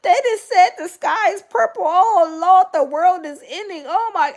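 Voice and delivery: high, mock panic of old folks; panicked old folks. Mimicking old folks panicking at the news, high and fretful. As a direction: ham up the elders' alarm. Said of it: "they be like".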